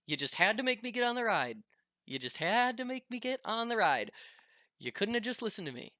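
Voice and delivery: high, fast, whining mock-complaint; imitating a friend's exasperated whine; whiny complaining friend. Derek whining after vomiting.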